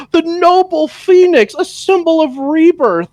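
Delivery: high, loud, showy announcement; hammed-up grand unveiling; grand presentation. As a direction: present the phoenix with mock grandeur. Horner presenting phoenix grandly.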